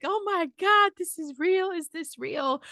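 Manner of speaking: high, choked-up cry of wonder; a retold tearful disbelief. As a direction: retell it as if tears are coming. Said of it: "I'm crying".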